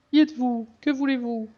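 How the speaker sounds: very high voice reading a pleading priestess; high-pitched NPC voice. Acted.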